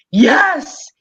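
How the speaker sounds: loud, high triumphant cry; hysterical yes. Retold or imitated. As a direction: shout it like vindication. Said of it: "I became hysterical".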